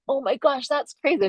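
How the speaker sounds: high-pitched gasp of retold wonder; mock amazement. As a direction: marvel in a high voice. Retelling amazement at film gadgets.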